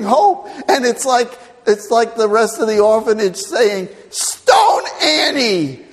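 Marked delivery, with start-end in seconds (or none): 4.1-5.8 slow, loud drawn-out mob cry; hammed-up orphans yelling; mock mob shout. Orphans yelling at Annie, hammed-up shout.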